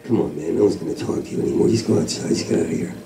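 low, soft, half-whispered mirror; mirror's low voice. Acted. The bathroom mirror tells him to leave, low and hushed. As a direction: murmur it like a gloomy mirror. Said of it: "he's like".

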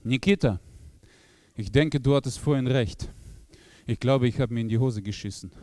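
put-on low Russian-accented confession; Russian-accented Viktor. Viktor mit Akzent. (tiefer russischer Akzent, beichtend)

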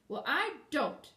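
slow, high, emphatic protest; firm declaration. A narrator's reading. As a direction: declare it stubbornly. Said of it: "declared the girl".

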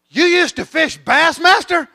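high, loud, drawn-out disbelief; amazed kid. Acted. Young fan questioning a fishing show host, amazed. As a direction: blurt it out slowly in astonishment. Kid's amazed reaction acted out.

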